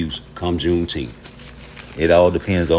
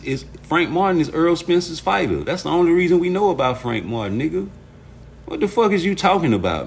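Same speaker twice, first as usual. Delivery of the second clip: higher-pitched exasperated rant; heated scolding; 7 semitones higher than usual. Real, not acted. Streamer arguing with a chat troll, heated. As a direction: tell them off, voice raised in pitch.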